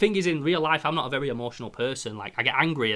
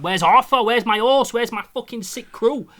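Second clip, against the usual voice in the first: louder, higher protest; mock outraged complaint; 8 semitones higher, 7 dB louder than usual. Complaining about the epilogue, loud and indignant. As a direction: demand your old life back. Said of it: "I'm just like".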